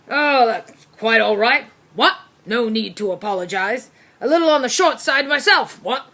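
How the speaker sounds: put-on blustering old officer, jovial; hearty colonel who barks his questions; pompous military dog. Corgi Brigadier reassuring Byrne about his height, blustering.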